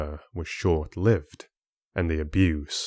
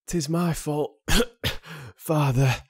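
higher, frail voice with a cough; coughing weak son; 7 semitones higher than usual. Acted. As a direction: cough through the words weakly. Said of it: "coughing into his hand".

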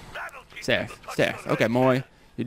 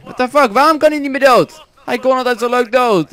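high, loud disbelieving outcry; indignant surprise; 7 semitones higher, 5 dB louder than usual. Real, not acted. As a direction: protest in disbelief. Verontwaardigd over zombie. (hoge verontwaardigde uitroep)

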